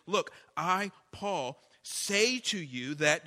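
"Look" punched loud, then "say to you that" slowed and spelled out; emphatic apostle. Retold or imitated. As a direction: lean hard on every word, like Paul insisting. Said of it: "he's really emphasizing this".

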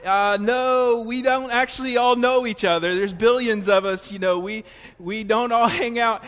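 retorting to the bookstore lady; exasperated reply. Retold or imitated. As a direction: push back loudly, half laughing. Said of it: "I'm like".

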